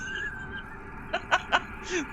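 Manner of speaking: squeaky delighted giggle; high squealing laugh. Real, not acted. High laugh after story.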